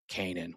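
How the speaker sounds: Canaan said KAY-nun; long A, stressed, at the start of Canaan; organization's pronunciation. Shown as a demonstration. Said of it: "how does the organization say it".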